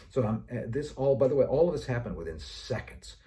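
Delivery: ordinary delivery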